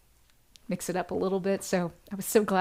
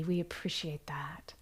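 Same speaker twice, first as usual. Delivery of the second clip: whisper; 3 semitones lower, 8 dB quieter than usual. Real, not acted. Host thanking donors on livestream.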